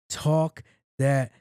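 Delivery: egging her on, dragging every word; slow drawn-out cheer. Retold or imitated. Cheering on the French lawmaker, slow and drawn out. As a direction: stretch Talk that shit out slowly, savoring each word. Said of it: "And I was like".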